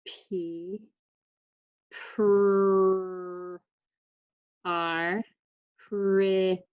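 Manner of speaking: P, pr, r, pri, t, e each separate; sounding out words. Shown as a demonstration. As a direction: tap out pretty one sound at a time. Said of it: "use my letter sounds again to tap out this word".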